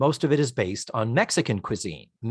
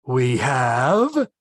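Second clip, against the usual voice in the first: deadpan. Retold or imitated. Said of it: "beautifully deadpan".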